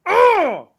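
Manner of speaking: high, loud "oh" falling away; big hyped-up yell; showman's holler. Real, not acted. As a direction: whoop after announcing the show. Hollering after the show title.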